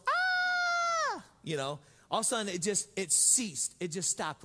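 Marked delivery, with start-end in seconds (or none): 0.1-1.2 shrill mock scream of terrified little girls; high girlish scream. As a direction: scream like a frightened little girl, hammed up. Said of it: "screaming like little girls".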